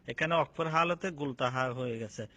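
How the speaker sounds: ordinary talk, nothing marked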